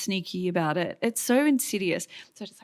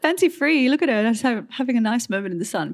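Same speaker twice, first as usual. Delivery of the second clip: mock gushing viewer; 6 semitones higher, 4 dB louder than usual. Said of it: "you'd be like".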